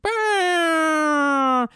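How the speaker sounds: very high, loud blare; long imitated siren-like wail; movie sound impression. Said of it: "here's my impression of".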